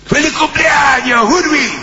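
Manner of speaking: very high, slow shouted cartoon voice; 1930s cartoon. Shown as a demonstration. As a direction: squeal it like an old cartoon. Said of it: "con voz de dibujo animado". (grito agudo de dibujo animado)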